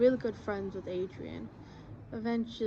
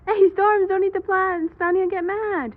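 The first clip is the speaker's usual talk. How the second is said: high, loud playful scolding; calling out warning; 11 semitones higher, 13 dB louder than usual. Real, not acted. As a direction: shout a warning down to a pet. Scolding cat eating plants.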